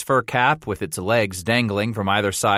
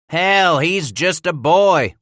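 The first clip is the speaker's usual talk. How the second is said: loud, higher, scornful grumble; gruff grumble; 8 semitones higher, 6 dB louder, slower than usual. Acted. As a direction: grumble it at the boy. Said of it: "one of the others grumbled".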